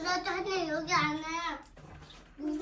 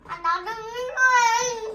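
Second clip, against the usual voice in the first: whiny crying; 4 semitones higher, 6 dB louder, slower than usual. Real, not acted. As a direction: whimper it out, close to tears. Child whining at father.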